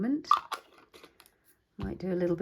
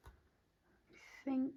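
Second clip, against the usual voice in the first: high, drawn-out soft musing; 8 semitones higher, 11 dB quieter than usual. Real, not acted. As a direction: stretch it out high and quiet.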